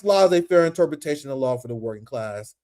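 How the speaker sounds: usual speaking voice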